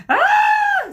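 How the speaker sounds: very high, loud shriek; startled scream. A narrator's reading. Medusa suddenly woken from her daydream, loud startled scream. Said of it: "screamed Medusa".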